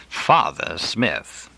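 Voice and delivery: "Father" drawn out slowly with sarcasm; sarcastic stress on Father. A narrator's reading. Mother mocking the priest's title, slow sarcastic "Father". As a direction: sneer the word "Father" slowly. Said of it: "accenting father sarcastically".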